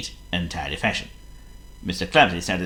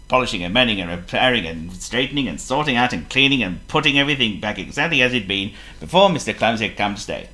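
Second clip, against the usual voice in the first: ordinary delivery, nothing marked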